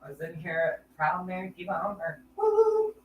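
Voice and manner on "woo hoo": high whooping cheer; excited cheer. Real, not acted. Host cheering to her live stream audience, excited whoop. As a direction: let out a happy whoop.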